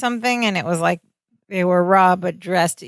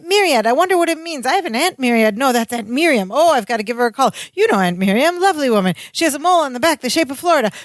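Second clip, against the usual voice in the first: rapid, rising, higher manic babble ending in a shout; fast manic rant; 5 semitones higher, faster than usual. Retold or imitated. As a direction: rattle it off like a man on speed. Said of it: "ranting real fast".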